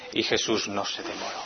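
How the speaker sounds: ordinary delivery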